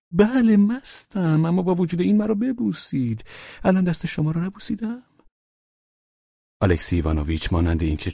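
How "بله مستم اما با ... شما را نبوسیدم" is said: put-on insistent drunkard. Drunken guest begs host for a kiss, insistent. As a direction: draw it out high, insisting.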